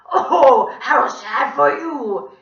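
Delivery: very loud, slow mocking squawk; drawn-out fake pity, high and harsh; terrible villain voice. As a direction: gloat in a screeching monster voice. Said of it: "he said in his terrible voice".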